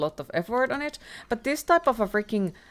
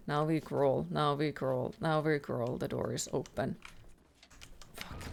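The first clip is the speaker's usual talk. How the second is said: ordinary talk, nothing marked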